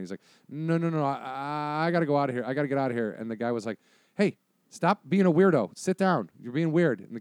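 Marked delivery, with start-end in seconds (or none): 0.5-3.1 higher, louder stammered refusal; acted panic, tripping over repeated words; panicked kid. Kid begging the detective to let him leave, panicked. 4.1-7.0 high, loud rebuke; scolding detective. Acted. Detective telling a spooked kid to sit down, scolding.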